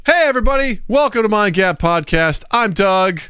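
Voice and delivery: high, lifted show-opening greeting; upbeat host welcome; podcast host intro. Real, not acted. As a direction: launch the show brightly, pitched well up. Podcast opening after the countdown.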